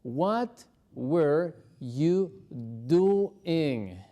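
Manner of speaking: drawn-out, stretched syllables; slow teacher drill. Shown as a demonstration. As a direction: stretch every word for the class. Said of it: "detengámonos un ratito ahí".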